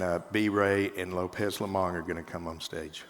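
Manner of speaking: normal talking voice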